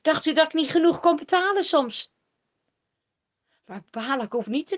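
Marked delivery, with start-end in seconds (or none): 0.0-2.1 louder, higher, reproachful king; indignant reproach, a touch hurt. A narrator's reading. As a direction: scold the seer for coming late. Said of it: "zegt hij verwijtend".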